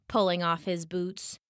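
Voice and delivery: plain reading voice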